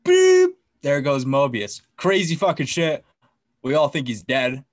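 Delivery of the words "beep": very high, loud imitated beep; a sharp, squeaky sound-effect beep; mock beep. Recapping Mobius getting pruned, a sharp imitated beep.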